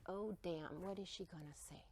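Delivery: whispered inner dread; whispered bracing for mom's reply; anxious whisper. Retold or imitated. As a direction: hush it like a worried thought. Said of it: "I start bracing".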